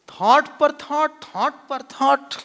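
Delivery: high, loud, slow chant-like repetition; relentless piling up. Shown as a demonstration. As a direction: hammer out each word, drawn out. Showing thoughts piling up relentlessly.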